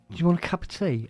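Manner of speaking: retold wife offering tea; a hint of the wife. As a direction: offer the tea sweetly in her voice.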